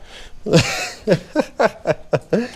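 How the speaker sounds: short rising disbelieving laugh; incredulous laugh. Real, not acted. Laughs at "sure.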